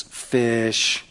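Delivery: normal talking voice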